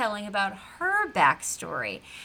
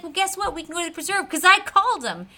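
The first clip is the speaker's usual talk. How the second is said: higher, fast, proud blurt; excited kid; 7 semitones higher, 4 dB louder, faster than usual. Retold or imitated. Gus proudly telling Big Man he called, excited. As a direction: rush it out like a proud child. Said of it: "And he's like".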